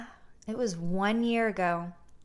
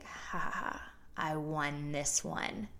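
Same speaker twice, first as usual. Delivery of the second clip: soft, whispered smug little laugh, slow finish; smug inner gloat; 2 semitones lower, 7 dB quieter, slower than usual. Retold or imitated. [name] silently gloating after shutting down the guy, hushed smug. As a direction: gloat quietly to yourself. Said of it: "I'm internally like".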